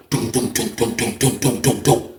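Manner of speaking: low rhythmic thudding knocks; voiced knock on a cell door; imitated door knocking. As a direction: sound out a heavy knock in even beats. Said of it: "I get a knock at the door".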